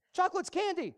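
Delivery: high, loud snap of a fed-up mom; exasperated mom. Retold or imitated. Mom in the store snapping at her begging child, exasperated. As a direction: shut the kid's trick down.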